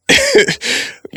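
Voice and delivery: a loud burst of high laughter. Real, not acted. Laughing at the memory.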